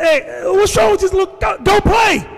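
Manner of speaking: high-pitched re-enacted holler; hollering at kids. Telling little kids to go play, high re-enacted holler. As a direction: holler it as if shooing children off.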